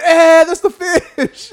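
high, very slow dopey voice through laughter; mocking Pancho. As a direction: play the clueless friend, drawn out and laughing. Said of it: "and it was like".

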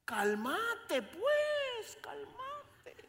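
put-on high childlike voice soothing grandpa; high toddler voice with a laugh; little girl voice. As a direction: play the small granddaughter calming him. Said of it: "¿Cómo es que dice Vela?".

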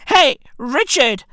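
ordinary talk, nothing marked

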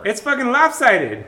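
high, loud complaint; comic exasperation. Real, not acted. Friend complaining about their own shower, exasperated shout.

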